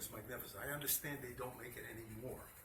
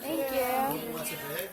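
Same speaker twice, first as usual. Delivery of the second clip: slow, much higher and louder call to the room; 11 semitones higher, 14 dB louder, much slower than usual. Real, not acted. Fabric seller offering bags to the class, loud slow call-out. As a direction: call out across the room to the crowd.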